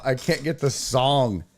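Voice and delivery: usual speaking voice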